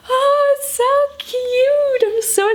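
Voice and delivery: very high, slow squeal of delight; gushing cute voice. Real, not acted. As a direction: coo it like you just saw a puppy. Gushing over finger puppets.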